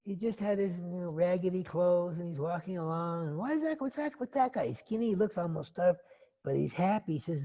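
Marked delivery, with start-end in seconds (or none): 3.4-4.7 high, rapid-fire questions; startled curiosity. Acted. Prince spotting a strange happy beggar, startled curiosity. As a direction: ask in astonished, high-pitched curiosity.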